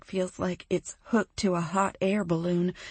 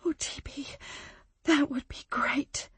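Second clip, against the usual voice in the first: relieved; 7 semitones higher, 4 dB quieter than usual. Acted. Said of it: "She sounds giddy with relief".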